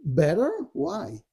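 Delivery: questioning lift on the first word; retold wondering. Yeshua questioning the dark voice, wondering. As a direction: echo it back as a puzzled question. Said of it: "Yeshua is wondering".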